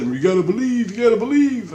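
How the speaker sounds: high, loud imitation of urgent insistence; mock urging. Retelling a big discussion with J C, mock urging. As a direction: pitch climbs as you mimic the pleading.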